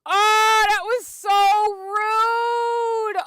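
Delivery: very slow, high, loud crying whine; teary protest at the game; tearful wail. Real, not acted. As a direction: sob out the complaint. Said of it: "I'm actually have tears".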